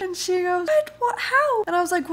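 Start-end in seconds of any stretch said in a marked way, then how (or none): 0.7-1.6 high pitched stunned What; mock alarm, jumping up high; shocked. Imitating the neighbour hearing her cat was run over, high and shocked.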